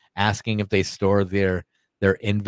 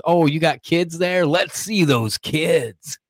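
higher, drawn-out mock Biden; stretched-out, raised-pitch Biden imitation; 6 semitones higher, much slower than usual. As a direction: slowly coo it as a mocking Biden. Mimics Biden leaning in to kids.

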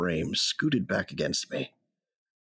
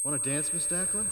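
tender ask; 8 semitones higher, 9 dB quieter than usual. Acted. Tender memory, very soft.

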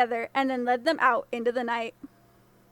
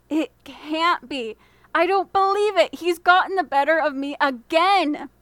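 disbelief; 3 semitones higher, 5 dB louder than usual. A narrator's reading. Governor realises he's tricked.

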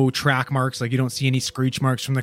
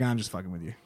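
low, much softer deadpan line; imitating a prankster's quiet deadpan; 6 semitones lower, 11 dB quieter, faster than usual. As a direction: drop it flat and quiet like a prank reveal. Bystander reveals prank deadpan.

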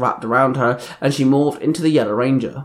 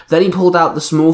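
ordinary delivery

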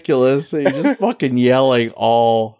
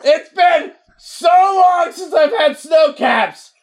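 mock yell; slower than usual. Yeller in the theater chatting about candy, shouting. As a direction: holler it slow and much higher. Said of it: "yell like a fucking idiot".

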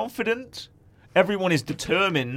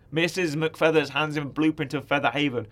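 ordinary delivery

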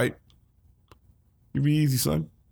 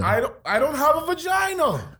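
protesting. Real, not acted. Protesting loudly.

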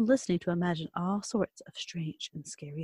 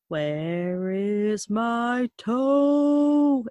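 slow drawn-out moaning voice; groaning ghost; 3 semitones higher, much slower than usual. Acted. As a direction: moan it very slowly, like a ghost. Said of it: "it groaned".

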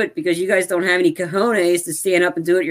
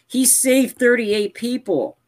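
"he saved thirty-eight people" slow and raised, each word weighted; emphatic; 6 semitones higher, slower than usual. Real, not acted. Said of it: "I wanna keep emphasizing".

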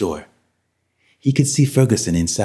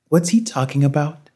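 higher-pitched, uneasy question; nervous question; 8 semitones higher than usual. A narrator's reading. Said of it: "Margaret asked nervously".

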